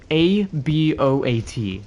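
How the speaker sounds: slow spelled letters A B O A T; spelled out slowly. Shown as a demonstration. Showing friends how the word sounds, slow spelling. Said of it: "That's what it sounds like".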